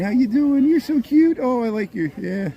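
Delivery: high sing-song pet voice; talking to a cute puppy. Real, not acted. Greeting dogs in costumes.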